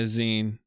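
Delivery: "zine" said "zeen"; long ee in "zine", rhyming with seen; "zine" as in magazine. Real, not acted. Streamer confirms from Reddit, says "zine" as "zeen". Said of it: "'Cause it's like a magazine".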